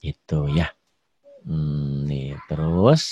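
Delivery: ordinary talk, nothing marked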